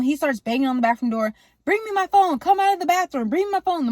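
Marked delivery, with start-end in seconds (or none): 1.6-3.9 high, insistent acted demand; urgent angry demand. Dad banging on the bathroom door for his phone, urgently demanding. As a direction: call it through a locked door.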